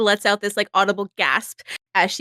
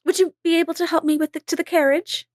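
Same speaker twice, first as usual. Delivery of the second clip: higher, quick pleading voice; helpless lady; 6 semitones higher than usual. Acted. [name] to Benny, asking help to the carriage, high sweet plea. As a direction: ask sweetly for rescue.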